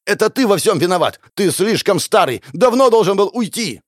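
high, shouted blame, rising at the end; furious accusation. A narrator's reading. Hero shoves the elder and blames him, shouting. Shoving quarrel, blaming the elder.

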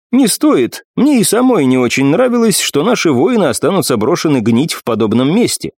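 a proud war goddess speaking; commanding warrior goddess. Acted. Nephrite voiced, higher.

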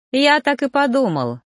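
high, merry reply; amused. A narrator's reading. Newcomer teases the grumpy mentor, amused. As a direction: toss it back gleefully. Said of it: "Харон вновь рассмеялся".

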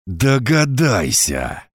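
slow, venomous hissed word; angry hiss. A narrator's reading. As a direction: hiss it out with menace. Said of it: "Зло прошипел ему я".

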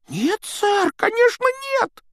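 very high, startled elf cry; exclaiming house-elf. A narrator's reading. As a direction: squeak it out in alarm. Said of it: "воскликнул эльф".

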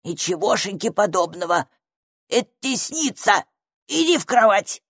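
grumpy little grumbler's voice; disgruntled creature. A narrator's reading. Hidden stranger orders girl to bed, grumpy grumble. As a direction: put on a cranky creature voice. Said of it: "Незнакомец недовольно закряхтел".

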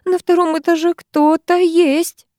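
slow, high-pitched scared bleating; thin-nerved, fearful high voice; frightened bleat. A narrator's reading. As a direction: draw it out in high dread. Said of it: "проблеяла Руднева".

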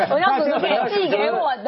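high laughing words. Real, not acted. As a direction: laugh through the line. Laughing at the joke.

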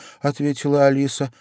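plain reading voice

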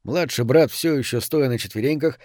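ordinary delivery, nothing marked

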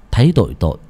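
ordinary delivery, nothing marked

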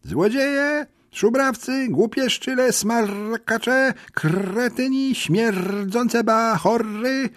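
bardzo wysoki, skrzeczący głos papugi; papuzi wrzask. Acted. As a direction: wrzeszcz obelgi jak rozwścieczona papuga. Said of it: "przeraźliwy wrzask Kubusia". (wysoki papuzi skrzek, wolno przeciągany)

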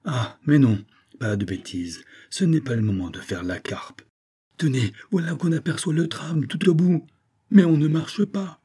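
lightly voiced girl, high and urgent at the end; girl rallying. A narrator's reading. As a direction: pull herself together and hurry him. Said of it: "Mais elle se redressa, disant". (Susan se reprend, impatiente)

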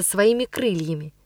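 ordinary delivery

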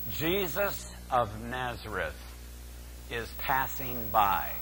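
soft, low and slowing; hushed announcement. Acted. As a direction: deliver the news in a hushed, weighty undertone. Crowd's answer voiced, hushed for weight.

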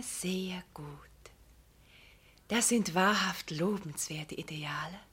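hushed, sly whisper; voiceless scheming murmur. Acted. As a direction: breathe it like a confidential aside. Sly flattery of the vicar.

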